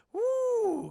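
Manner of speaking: loud, high held "woo"; excited whoop. Real, not acted. Streamer greeting a viewer, high whoop. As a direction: let out a long, high cheer.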